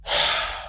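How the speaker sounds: long breathy sigh. Acted. Elf left alone after the orcs leave, sighing. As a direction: let out a long, resigned sigh. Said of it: "sit there and go".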